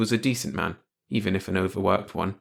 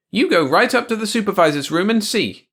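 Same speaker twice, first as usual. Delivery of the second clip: high, loud order; commanding wife; 12 semitones higher, 7 dB louder than usual. A narrator's reading. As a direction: send the husband off firmly. Wife sends George off.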